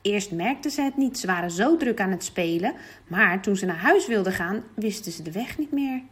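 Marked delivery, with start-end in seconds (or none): none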